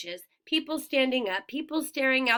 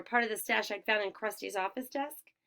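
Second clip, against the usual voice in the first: ordinary delivery, nothing marked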